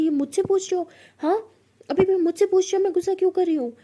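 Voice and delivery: high, loud indignant questions; hammed-up outrage; furious disbelief. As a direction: girlfriend yelling back at a clueless question. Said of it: "बहुत ज़्यादा गुस्सा कर रही होती है".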